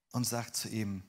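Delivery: usual speaking voice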